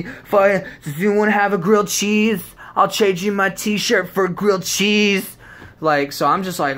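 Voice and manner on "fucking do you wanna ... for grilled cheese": loud, high hammed-up plea; mock desperate high-pitched shouting; freaked-out rave guy. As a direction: holler it like a frantic wasted festival-goer. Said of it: "I don't wanna be that guy".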